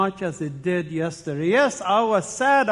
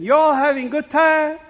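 [name] voice; 8 semitones higher, 5 dB louder than usual. Retold or imitated. Guest imitating a hotel greeting in a Southern lady voice. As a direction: put on a bright Southern lady's greeting. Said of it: "I hear [name]'s voice saying".

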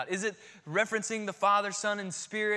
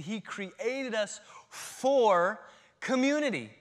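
building preaching emphasis; 5 semitones higher, 5 dB louder, slower than usual. Acted.

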